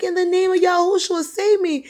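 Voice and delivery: high desperate plea for rescue; loud, very high-pitched invocation; retold screamed prayer. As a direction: imitate him crying out the prayer. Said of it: "he was screaming this loud".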